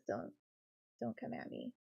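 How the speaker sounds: soft, defensive aside; sheepish quiet deflection. Real, not acted. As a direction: mutter it off under your breath. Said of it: "came out of my mouth that way".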